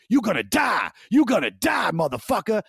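high, loud, snarling threat from a mugger; menacing threat. Retold or imitated. Mugger choking him snarls a death threat, loud and high. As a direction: bark it like a choking attacker. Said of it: "the dude that's choking me is like".

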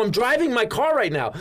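high, exasperated comic outburst; flustered protest. Retold or imitated. Fan phoned while driving by a star's wife, flustered protest.

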